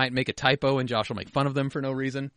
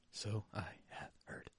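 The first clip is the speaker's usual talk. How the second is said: breathy whispered aside; 3 semitones lower, 16 dB quieter than usual. Shown as a demonstration. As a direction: whisper it knowingly. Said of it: "repeats line in whisper".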